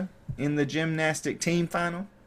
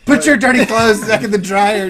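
frantic host; 6 semitones higher, 10 dB louder than usual. Pretend. Frantic host voiced mid-tidy.

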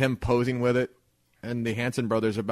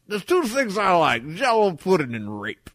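hammed-up voice, higher and louder; slow, pitched-up mock celebrity delivery; Bill Cosby; 7 semitones higher, 5 dB louder than usual. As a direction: play it big and drawn out, as a joke. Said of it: "Bill Cosby's picture smiling".

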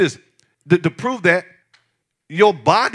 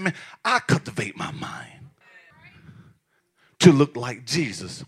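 hushed quote; 4 semitones lower, 7 dB quieter than usual. Retold or imitated. Quoting Paul, hushed.

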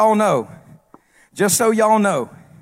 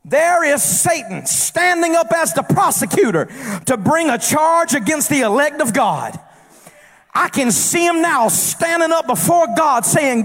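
rising, shouted sermon cadence in a long crescendo; full-force preaching; 9 semitones higher than usual. Acted. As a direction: preach it hard and build the congregation up.

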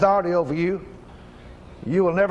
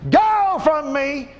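slow, loud, drawn-out command; commanding shout; 7 semitones higher, 4 dB louder, much slower than usual. Real, not acted. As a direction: order it out with full force. Commanding cancer to leave.

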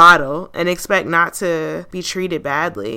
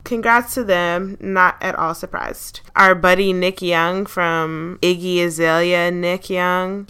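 ordinary talk

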